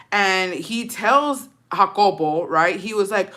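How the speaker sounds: ordinary talk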